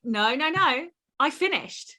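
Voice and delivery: ordinary talk, nothing marked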